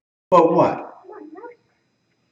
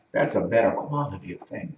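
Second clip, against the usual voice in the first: normal talking voice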